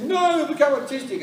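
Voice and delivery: high-pitched imitation of an upset wife; hammed-up worried wife; mock protest. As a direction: object in mock alarm, pitch shooting up. Acted quarrel, wife protesting.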